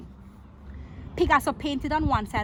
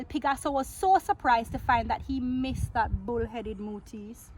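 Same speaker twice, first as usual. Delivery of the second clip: ordinary delivery, nothing marked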